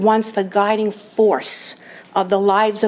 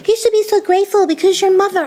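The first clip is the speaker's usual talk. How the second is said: high, louder mock lecturing voice; sing-song mock sponsor, pitched way up; imitating the sponsor; 10 semitones higher, 4 dB louder, faster than usual. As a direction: put on a preachy high voice to mock her. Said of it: "imitate her this way".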